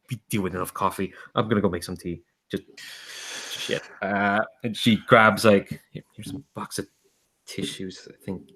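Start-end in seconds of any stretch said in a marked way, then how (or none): 5.9-8.5 much softer, unsure offer; awkward hush. Acted. Enid awkwardly offering Melkor a box of tissues, hushed and unsure. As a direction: hand them over, awkward and barely voiced.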